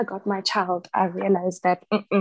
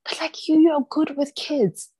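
mock surprised people; 8 semitones higher than usual. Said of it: "people will look at you and be like".

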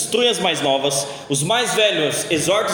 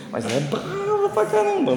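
teasing about wife; 12 semitones higher, 3 dB quieter than usual. Acted. Joking his wife is fierce.

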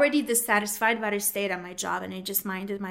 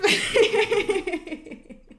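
high embarrassed laugh; 11 semitones higher, 4 dB louder than usual. Real, not acted. Laughing at herself after choking.

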